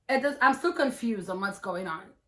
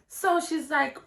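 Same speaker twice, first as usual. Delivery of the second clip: normal talking voice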